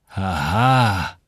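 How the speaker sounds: low, long drawn-out "aha"; pensive realisation. Acted. Samuel absorbs Joel's news after a silence, pensive. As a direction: let it sink in slowly.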